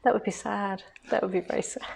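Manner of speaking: softly feigned sorrow, almost genuine; mock sad. Wife pretending sorrow about upgrading her husband, mock sad. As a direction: say it softly as if truly sorry. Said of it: "That sounded almost genuine".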